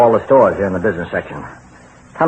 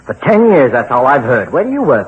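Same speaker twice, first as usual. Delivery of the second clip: higher, louder exasperated outburst; angry rant; 6 semitones higher, 4 dB louder than usual. Acted. Husband vents at nagging wife, loud and exasperated. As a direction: unload ten years of annoyance.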